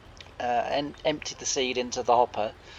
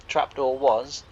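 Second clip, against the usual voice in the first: usual speaking voice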